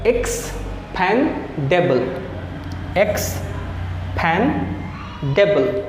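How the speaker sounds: एक्सपैंडेबल stretched very slowly, eks-pan-de-bal; syllable by syllable. Shown as a demonstration. Teacher sounding out a long word slowly for practice. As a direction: drag each syllable of एक्सपैंडेबल out.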